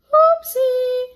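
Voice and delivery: put-on high, loud sheepish child; sheepish oopsie. Annie caught painting by teacher, high sheepish. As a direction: squeak it out caught red-handed.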